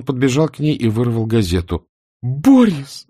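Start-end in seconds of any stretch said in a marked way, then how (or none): 2.2-3.1 louder high cry of his name; wife crying out in alarm; shout. A narrator's reading. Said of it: "закричала она".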